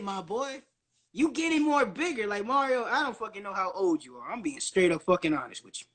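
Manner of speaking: ordinary talk, nothing marked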